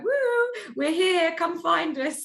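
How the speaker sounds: high, loud sing-song call out; mock call. Alarm calling to travelers in a high mock shout. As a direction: call out like a beacon luring people in.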